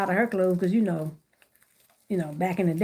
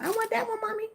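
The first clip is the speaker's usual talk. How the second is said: put-on high pleading child voice, fading softer; whiny kid begging; 12 semitones higher, 5 dB quieter than usual. Mom hears her kid begging for that basket, high whiny child voice. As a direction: beg like a little kid for the basket. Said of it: "They want".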